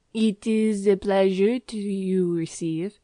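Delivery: put-on lightly French-accented landlady, slow and gushing; a stout Parisian landlady welcoming guests, gushing French-accented English. Excited landlady greeting new lodgers, French-accented and gushing. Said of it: "she cried".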